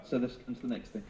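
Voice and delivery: ordinary talk, nothing marked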